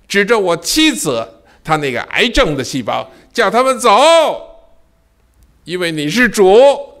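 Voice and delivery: high, loud impassioned plea to God; fervent pleading prayer. Acted. Preacher reenacting his plea to God, impassioned and high. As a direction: beg God with full force.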